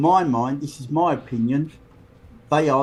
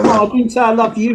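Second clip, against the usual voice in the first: emphatic correction; 7 semitones higher, 6 dB louder, faster than usual. Real, not acted. Friend corrects a mistaken "love you.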